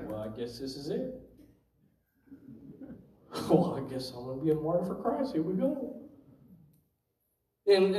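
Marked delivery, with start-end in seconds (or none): 0.1-6.0 resigned hush. Retold or imitated. Facing gunmen, resigned to die.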